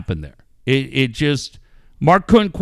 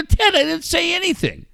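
1 retold protest; 13 semitones higher than usual.

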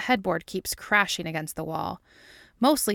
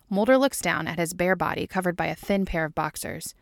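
plain reading voice

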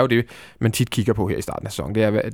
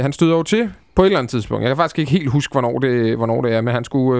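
normal talking voice

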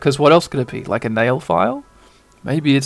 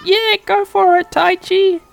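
very high, squeaky cheering; high cutesy mascot encouragement; Koromon. Acted. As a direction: cheer on a friend as a cute little creature. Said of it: "Koramon's like".

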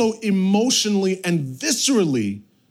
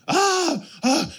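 high-pitched imitated baby wail; mock baby scream; 14 semitones higher than usual. Dad imitating his screaming newborn daughter, mock scream. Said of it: "She's just screaming".

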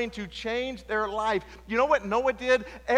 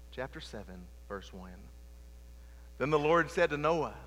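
soft, lowered preaching voice; quiet sermon; 7 semitones lower, 5 dB quieter than usual. Acted. Preacher slows into the ark story, quiet preaching voice. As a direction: draw them in close and quiet.

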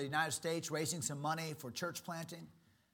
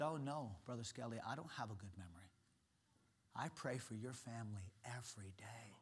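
sincere hushed confession; 6 semitones lower, 13 dB quieter than usual. Retold or imitated. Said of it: "He said".

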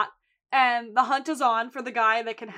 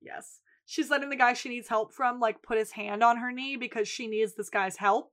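normal talking voice

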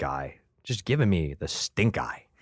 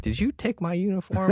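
mock accusing; 12 semitones higher than usual. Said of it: "I'm like".

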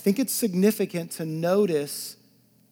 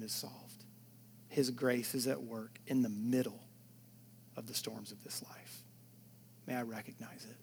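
soft, low, half-whispered confession; hushed intimate preaching; 4 semitones lower, 9 dB quieter than usual. Acted. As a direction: draw them in close with a hush. Intimate hushed reflection on waiting.

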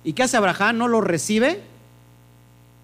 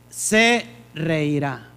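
"Se reirá" stretched slowly, syllable by syllable; slow, drawn-out delivery; 3 semitones lower, much slower than usual. Shown as a demonstration. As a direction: lay out "Se reirá" slowly, as if explaining it. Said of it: "slow spelled-out meaning".

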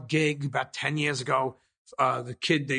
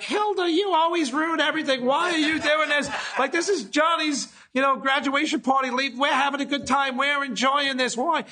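put-on high whiny old lady voice; higher, pleading old lady nagging; old lady scolding; 9 semitones higher than usual. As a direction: scold her sister in a higher pitch. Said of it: "she's like".

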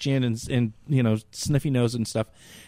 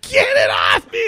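laughing plea; 6 semitones lower than usual. Retold or imitated. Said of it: "I'm like".

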